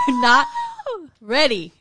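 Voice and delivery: high, loud teasing tease; teasing buildup. Real, not acted. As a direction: drag out the suspense playfully.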